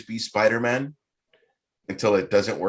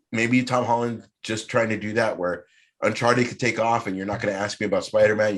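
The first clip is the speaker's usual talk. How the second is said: ordinary talk, nothing marked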